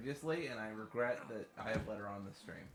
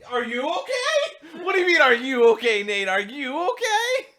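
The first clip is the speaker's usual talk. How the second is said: outraged yelling; 20 dB louder than usual. Real, not acted. Streamer yelling back at a teammate's question, outraged. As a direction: shriek the question back in disbelief.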